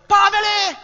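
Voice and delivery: high, loud call of a name; shouted warning. Retold or imitated. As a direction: yell to stop him stepping. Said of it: "Beni țipă din spate".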